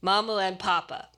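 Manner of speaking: ordinary delivery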